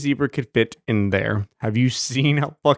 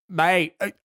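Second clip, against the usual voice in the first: a corrected Aussie accent, a bit higher; better Australian accent; 4 semitones higher than usual. Shown as a demonstration. To a friend correcting his accent, an Australian accent. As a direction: show him how an Australian says it. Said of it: "It's more like".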